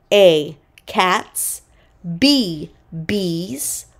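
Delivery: ordinary delivery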